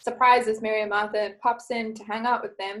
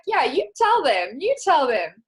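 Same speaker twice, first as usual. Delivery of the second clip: cheering along; 4 semitones higher, 6 dB louder, slower than usual. Retold or imitated. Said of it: "And I'm like".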